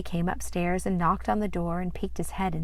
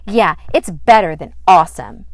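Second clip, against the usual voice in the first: proclaiming; 12 dB louder than usual. Acted. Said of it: "Sandy proclaimed".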